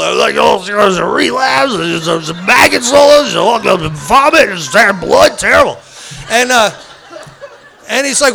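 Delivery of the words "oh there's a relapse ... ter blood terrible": frantic retold rant. Acts out his own frantic share.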